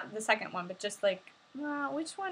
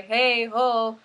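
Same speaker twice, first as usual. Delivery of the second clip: usual speaking voice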